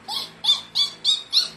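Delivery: very high little piglet giggles; squeaky piglet giggle. Acted. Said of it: "all the piglets went".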